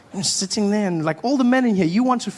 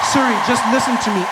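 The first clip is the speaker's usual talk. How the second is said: exasperated; 7 semitones higher, 5 dB louder, much faster than usual. Retold or imitated. Frustrated with Siri.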